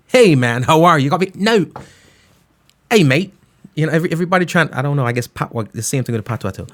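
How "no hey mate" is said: exaggerated English greeting, higher on "No"; mock an English "hey mate"; mock English accent. DJ mocking people who put on English talk, English accent. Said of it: "they start talking like that".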